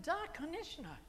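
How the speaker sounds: high-pitched, emphatic Russian yes; energetic Russian. Shown as a demonstration. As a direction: affirm it eagerly, pitched well up. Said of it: "the energy is the same in all those languages".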